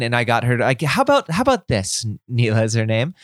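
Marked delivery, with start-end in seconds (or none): 0.8-2.0 upbeat suggestion. Retold or imitated. Dad redirecting daughter, cheerful pitch.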